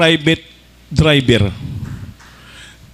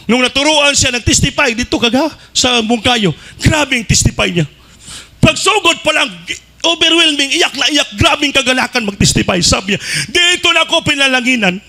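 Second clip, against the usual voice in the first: high, excited sermon telling; fervent preaching; 8 semitones higher than usual. Acted. Preacher relating a soldier's joyful testimony, fervent and high. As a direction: lift the testimony with fervour.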